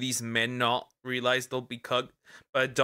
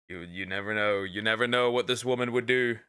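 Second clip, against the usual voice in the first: ordinary delivery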